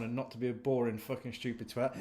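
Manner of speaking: ordinary delivery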